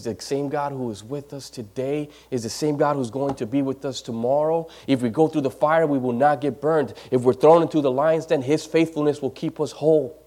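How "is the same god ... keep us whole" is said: ordinary talk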